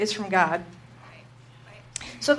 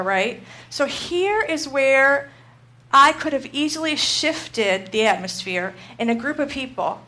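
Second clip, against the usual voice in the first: usual speaking voice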